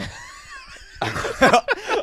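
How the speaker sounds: high shrieking laugh; loud burst of laughter. Real, not acted. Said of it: "I started laughing".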